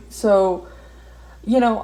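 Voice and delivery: ordinary delivery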